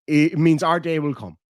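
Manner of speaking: normal talking voice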